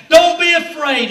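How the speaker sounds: loud, slow call of don't be afraid; Jesus calling to frightened disciples over the storm, loud and slow; commanding shout. Acted.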